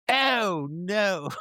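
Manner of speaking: high, drawn-out fake wail of dismay; mock dismay. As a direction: pretend to be devastated for them. Mock sympathy for outdone media giants.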